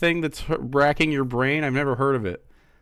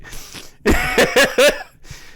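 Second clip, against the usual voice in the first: breathy laugh; 8 semitones higher, 4 dB louder than usual. Real, not acted. Laughing at own sarcasm.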